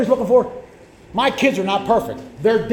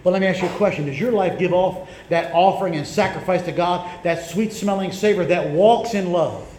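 ordinary talk

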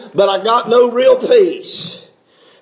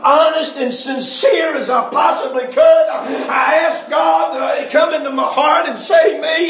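preaching at full force; 5 semitones higher than usual. Real, not acted. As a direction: cry it out to the church, building to a peak. Testimony rising to full preaching.

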